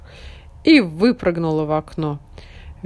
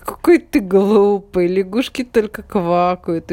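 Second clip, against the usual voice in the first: mocking frog voice; 6 dB louder than usual. A narrator's reading. Frog mocks puppy.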